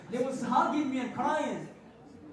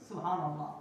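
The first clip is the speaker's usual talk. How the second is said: normal talking voice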